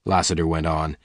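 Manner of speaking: plain reading voice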